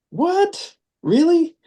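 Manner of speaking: high-pitched incredulous questioning; what-on-earth reaction, pitched very high. Retold or imitated. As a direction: re-enact stunned disbelief, pitch leaping way up. Retelling his disbelief at her.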